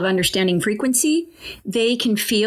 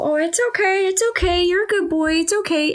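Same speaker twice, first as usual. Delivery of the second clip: high, gentle reassuring voice, much higher; soothing the horse; 9 semitones higher than usual. Retold or imitated. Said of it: "And I go".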